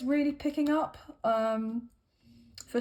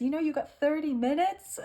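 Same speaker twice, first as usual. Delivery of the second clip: a bad American Irish accent, higher and laughing; 4 semitones higher than usual. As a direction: laugh through a playful Irish lilt. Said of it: "weird American Irish accent".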